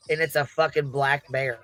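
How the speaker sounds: normal talking voice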